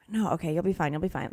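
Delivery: quiet, low self-reassurance; talking herself down softly; self-soothing mutter. Retold or imitated. Hiker calming herself in the crowd, soft and low.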